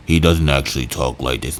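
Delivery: exaggerated deep voice. Shown as a demonstration. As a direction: sink the voice to the floor to mock him.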